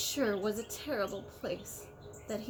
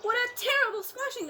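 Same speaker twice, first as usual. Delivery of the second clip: much higher and louder, an exclaiming frown; frowning elephant; 13 semitones higher, 5 dB louder than usual. A narrator's reading. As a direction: cry out in disapproval at the splash. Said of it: "the elephant frowned".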